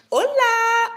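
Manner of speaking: high, loud, bright Spanish greeting; delighted Spanish hello; excited hola. Real, not acted. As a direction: throw out the Hola with glee.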